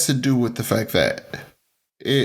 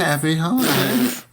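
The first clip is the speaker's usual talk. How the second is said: raised, cheery sign-off; festive echo; 5 semitones higher than usual. Pretend. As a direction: echo the holiday greeting in a lifted voice. Echoes co-host's festive sign-off.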